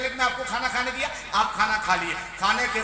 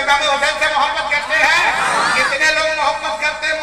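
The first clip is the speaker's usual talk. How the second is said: same question thrown out louder to the crowd; preacher loudly rousing the gathering; louder rousing call; 4 semitones higher, 8 dB louder than usual. Shown as a demonstration. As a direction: push it out loud to stir them. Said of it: "अरे बोले, भाई".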